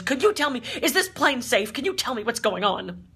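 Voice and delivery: high, loud, agitated questions; storming demand. Retold or imitated. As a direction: mimic an upset passenger confronting crew. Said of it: "goes storming up to the front".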